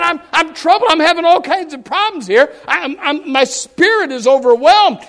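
high, loud venting of troubles; acted distressed complaint. Preacher voicing the troubled psalmist, distressed complaint. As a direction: pour it out like someone overwhelmed.

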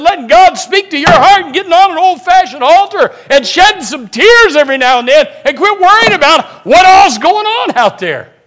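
high, insistent pulpit delivery; full-force preaching. Real, not acted. Preacher telling the church what fires them up, full force. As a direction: hammer it home in long driving phrases.

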